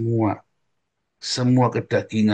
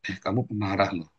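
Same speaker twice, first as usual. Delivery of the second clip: softer self-warning; quiet inner warning; 2 semitones lower, 7 dB quieter than usual. Retold or imitated. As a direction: murmur it to yourself. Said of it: "saya tahu".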